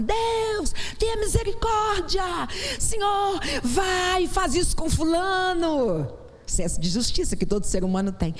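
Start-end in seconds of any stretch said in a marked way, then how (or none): 0.0-6.1 mock outraged plea for justice; vengeful shouted prayer. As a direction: shout it up to heaven, demanding payback. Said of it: "Porque a gente fala assim".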